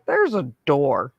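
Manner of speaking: ordinary delivery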